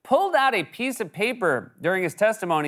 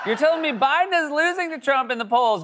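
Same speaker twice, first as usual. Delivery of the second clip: incredulous; 10 semitones higher than usual. Real, not acted. Incredulous rant.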